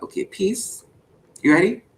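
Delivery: ordinary delivery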